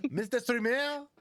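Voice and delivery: put-on high, slow French-accented Mister Streamer; mock French accent. Friend plays the French fan calling the streamer, mock French accent. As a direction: purr it like a snooty Frenchman. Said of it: "this French person".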